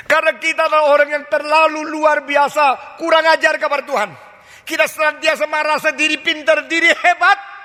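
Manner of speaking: high, loud, fiery sermon delivery; full-force revival preaching; forceful preaching. Real, not acted. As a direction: preach hard, rebuking the congregation.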